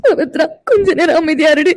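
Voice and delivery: sobbing plea. Acted. Amirtha sobs, begging her friend to stop. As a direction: plead through broken sobs.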